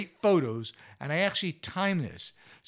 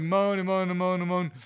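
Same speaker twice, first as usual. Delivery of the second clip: mock moaning, pitched up and louder; moany voice; 4 semitones higher, 5 dB louder than usual. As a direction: imitate a droning chorus of moans. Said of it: "in this moany voice".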